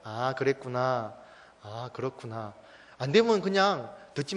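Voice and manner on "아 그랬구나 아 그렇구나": slow, soft, drawn-out acknowledgement; accepting, understanding. Shown as a demonstration. Pastor shows parents how to keep listening to a child, calmly accepting. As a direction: keep receiving it calmly, stretching the first sound. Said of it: "어떻게 해요? 계속".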